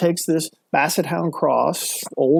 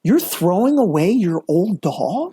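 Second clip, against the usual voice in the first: rising, incredulous mock outrage, high at the end; imagined onlooker scolding an old man, mock outrage; 4 semitones higher than usual. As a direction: play up disbelief at the old man. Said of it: "how I would have seen it is like".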